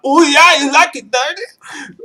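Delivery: very high, drawn-out saucy squeal; slow, high-pitched cheesy come-on; sleazy call sign. Acted. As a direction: ham up the dirty-talk signal in a high voice. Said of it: "So here we go".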